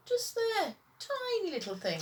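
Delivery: high, light voice; a small voice spotting the ladybird; high character voice. A narrator's reading. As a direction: point it out delicately.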